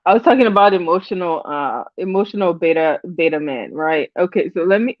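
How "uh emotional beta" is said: ordinary talk, nothing marked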